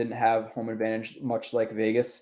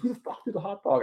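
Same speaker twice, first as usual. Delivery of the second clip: retold angry yell; 7 semitones higher, 4 dB quieter than usual. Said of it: "stood up on the seat".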